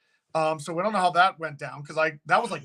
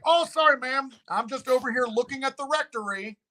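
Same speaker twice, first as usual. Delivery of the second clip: much higher, overly polite voice; polite apology; 7 semitones higher than usual. Retold or imitated. Visitor to a yelling neighbor, high polite apology. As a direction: sweet-talk the angry neighbor.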